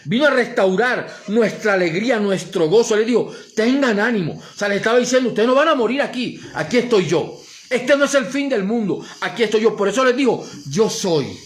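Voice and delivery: loud, high, driving sermon voice; full-force preaching. Acted. Pastor preaching to the congregation, fervent and loud.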